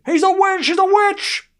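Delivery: shrill, high mock witch-hunt cry; high mock villager yelling witch; accusing villager. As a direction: point and cry witch like an old-time mob. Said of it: "that would happen if you were back there".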